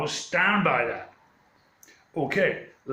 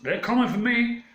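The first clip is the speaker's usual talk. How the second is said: mock panic; 9 semitones higher than usual. Police arrive, mock panic.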